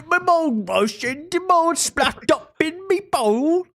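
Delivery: overdone cockney-ish mock voice; mock British. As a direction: squeal it like a caricature Brit. Mocking British slang.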